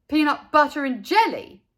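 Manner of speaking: loud incredulous question; can't believe his ears; in disbelief. A narrator's reading. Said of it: "asked Buster in disbelief".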